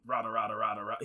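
low, muttered rada rada gibberish; Schnitzel gibberish. Retold or imitated. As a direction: mumble rada rada like a creature. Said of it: "he doesn't talk normal".

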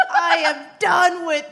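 high toddler yell, lightly voiced. Mom imitating her son's high mock yell at the play space. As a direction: shout it like a fed-up little boy. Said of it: "He also said".

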